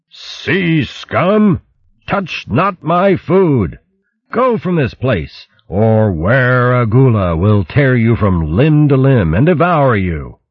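high, slow booming threat. Acted. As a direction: boom it out like a monster warning off intruders. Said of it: "loud, booming voice echoing out of the stillness".

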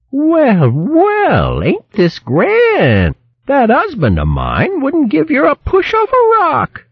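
high, scolding country woman voice; shrill hedgehog wife scolding. Acted. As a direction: berate him like a fierce old hog wife. Merklewort pleased, mocks husband.

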